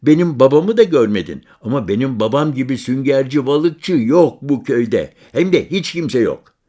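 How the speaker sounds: put-on high, loud bragging boy; boastful child. Boy boasting to the stranger about his father, high and loud. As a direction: brag about your father like a kid.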